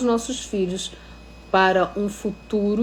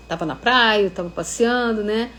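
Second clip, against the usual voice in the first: normal talking voice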